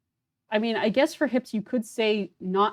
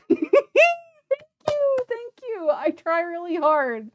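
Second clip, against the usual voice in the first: high, drawn-out grateful squeal; gushing thanks; 7 dB louder than usual. Real, not acted. Streamer thanking chat for praise of the voice acting, high gushing squeal. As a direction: squeal your thanks slowly, pitched way up.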